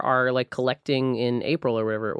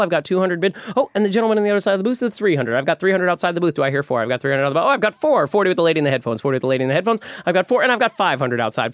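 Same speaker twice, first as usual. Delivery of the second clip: fast, loud auction chant; auctioneer; 4 semitones higher, 5 dB louder than usual. Pretend. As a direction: rattle off bids like a live auctioneer. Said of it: "So give us a little".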